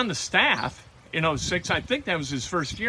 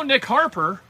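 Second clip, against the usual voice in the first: ordinary delivery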